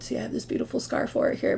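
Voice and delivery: hushed, breathy aside about the scar; a soft confiding whisper. Real, not acted. Showing her scar quietly.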